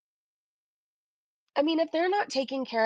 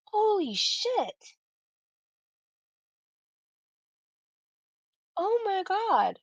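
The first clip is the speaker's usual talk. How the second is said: shocked; 3 semitones lower, much slower than usual. Real, not acted. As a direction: stretch it out in stunned horror. Shocked at the collapsing wall.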